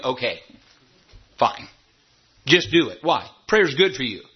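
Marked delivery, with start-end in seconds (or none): none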